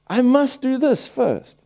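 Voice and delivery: slow, high, stress on this; emphatic inner resolve. Shown as a demonstration. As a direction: say it like a new mantra. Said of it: "to think".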